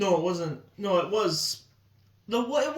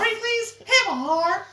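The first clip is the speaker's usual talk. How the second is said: high pleading voice; 10 semitones higher, slower than usual. Retold or imitated. Quoting a character.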